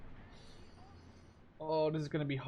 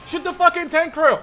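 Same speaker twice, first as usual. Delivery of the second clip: screamed order, much higher and louder; furious shout; 19 dB louder than usual. Real, not acted. Gamer screaming orders at his units, high and loud. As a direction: bellow it at the crew.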